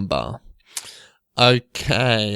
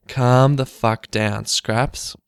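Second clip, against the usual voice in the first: ordinary talk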